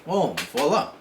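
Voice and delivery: normal talking voice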